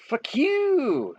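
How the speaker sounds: high, drawn-out mock war cry; hammed-up battle yell, long last word. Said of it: "yell with my battle cry".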